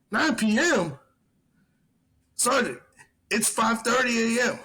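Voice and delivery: high, disbelieving echo, then the correction; can't believe what he's hearing; incredulous. Retold or imitated. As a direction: soldier setting his drunk sergeant straight. Retold argument with drunk sergeant.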